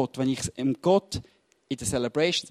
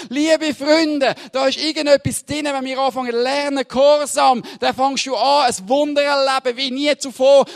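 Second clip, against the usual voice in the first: plain; 9 semitones higher, 8 dB louder than usual. Acted. Preaches with full force.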